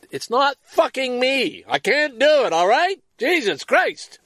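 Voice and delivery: loud self-directed rant at his own limits; yelling at himself. Real, not acted. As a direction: snap at yourself in real frustration. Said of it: "that's me yelling at me".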